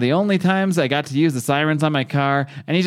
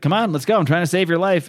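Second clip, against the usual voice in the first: urgent plea; 6 semitones higher than usual. Retold or imitated. Glenn urging Rick up ladder.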